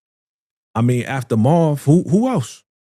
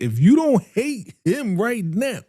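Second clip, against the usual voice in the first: high mock outrage; 9 semitones higher than usual. Mock outraged rant at viewers.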